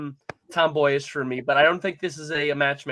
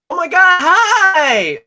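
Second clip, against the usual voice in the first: loud, much higher squealing princess; cartoon princess; 14 semitones higher, 12 dB louder, much slower than usual. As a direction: shriek a giddy hello like a princess. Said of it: "I gotta do my princess impression".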